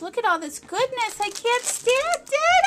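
rising to a very high squeal; overwhelmed squeal. Real, not acted. Overwhelmed by Maureen's gift, a climbing high squeal. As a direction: lose it over the goodness.

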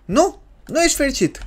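high-pitched incredulous question; mock disbelief. Player to unhappy baby, high mock disbelief.

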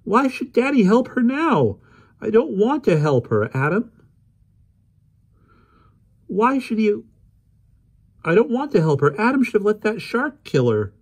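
put-on high, loud, bitter girl's voice; indignant young girl. Polly arguing with Adam, high indignant girl. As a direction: refuse to forgive the killer.

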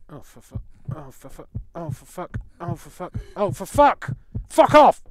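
muttered to furious shout; building frustration. Real, not acted. Repeated swearing rising to outburst.

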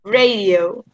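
"Radio" as ray-dee-oh; English radio. Shown as a demonstration. Teacher prompting Lauri to repeat, Radio as ray-dee-oh. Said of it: "En inglés se dice radio".

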